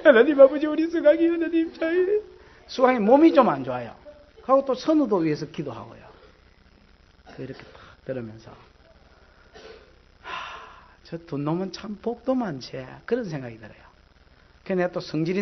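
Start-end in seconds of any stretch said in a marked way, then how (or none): 0.0-2.2 higher, pressing prayer voice; the fired-up prayer tone, pitched higher. Shown as a demonstration. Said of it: "열받으면 그 딱 하는 그 톤이 있어요". 10.2-12.8 envious muttering. Retold or imitated. Jealous inner thought.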